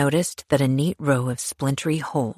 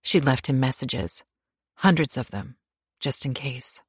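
ordinary delivery, nothing marked

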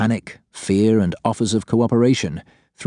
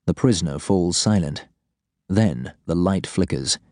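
ordinary delivery

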